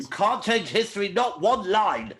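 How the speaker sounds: lightly voiced stern old Doctor; William Hartnell. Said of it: "said William Hartnell in The Aztecs".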